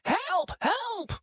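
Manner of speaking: very high, softened mock scream; thin falsetto shriek, held back; imitated older lady screaming. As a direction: mimic a woman's cry for help without the volume. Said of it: "we heard an older lady screaming".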